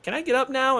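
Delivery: imitating polite request. Actress on cold ground asks director between takes, imitated high-pitched request. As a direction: lift it high to mimic her asking.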